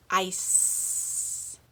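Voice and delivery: ICE with a long drawn-out S; long final S. Shown as a demonstration. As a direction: stretch the s sound ending ice. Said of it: "Do you hear the difference?".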